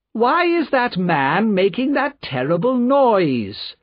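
high, slow, haughty impatience; impatient nobleman's drawn-out question. A narrator's reading. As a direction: draw it out high, as an irritated aristocrat. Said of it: "asked the Marquis impatiently".

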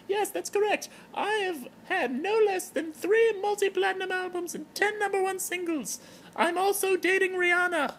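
Terry the giraffe. Acted. Terry the giraffe greeting her blog fans in falsetto. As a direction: greet the fans like a tipsy celebrity in a high voice.

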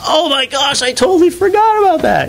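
high-pitched imitation of remembering; retold burst of surprise; sudden realization.

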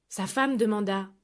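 ordinary delivery

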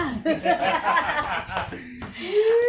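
high giggling laugh; burst of laughter. Real, not acted. Laughs alone between pitches.

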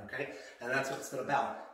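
ordinary talk, nothing marked

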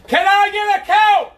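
slow, loud, drawn-out shout. Real, not acted. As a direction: bellow it across the room. Third unanswered call, shouted.

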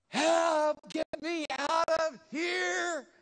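high, slow, shouted cry for help. Retold or imitated. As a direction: yell from inside the bucket, stretched out. Preacher acts trapped in bucket.

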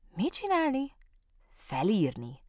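puzzled repeat of "Felírni?"; ask, not getting the word; baffled question. A narrator's reading. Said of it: "kérdezte megint Kóbor Muki".

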